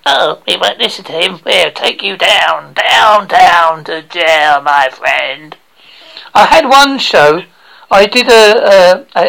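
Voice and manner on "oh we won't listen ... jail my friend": slow, drawn-out mock warning; mock threat. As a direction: stretch the words out like a hammed-up threat. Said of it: "people get funny and they might say".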